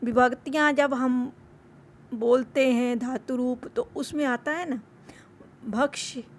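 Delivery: ordinary talk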